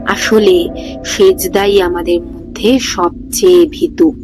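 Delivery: plain reading voice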